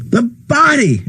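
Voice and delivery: high, urgent cry; frantic shout at the crowd. A narrator's reading. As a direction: shout it out in horror. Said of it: "Ed shouted".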